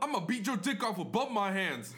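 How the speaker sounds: loud, high mock threat; hammed-up roid-rage threat; steroid rage. Raging customer threatening a coworker, hammed-up fury. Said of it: "like, steroid rage".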